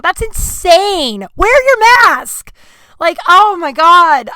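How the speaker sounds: high, loud and slow, fed up; real outrage, drawn out high; exasperated rant. Real, not acted. Ranting at anti-mask protesters.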